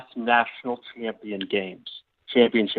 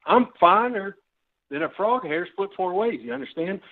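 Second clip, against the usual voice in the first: Southern grandfather; 6 semitones higher than usual. Acted. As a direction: put on a folksy Southern grandpa accent. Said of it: "Why are you suddenly a Southern grandfather?".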